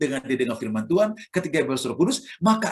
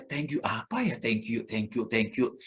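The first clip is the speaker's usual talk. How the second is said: softer, puzzled repeating of "thank you"; puzzled murmur; 5 semitones lower, 7 dB quieter than usual. Retold or imitated. Learner puzzling over a strange English phrase, quiet. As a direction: mutter it to yourself, confused. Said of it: "Awalnya saya".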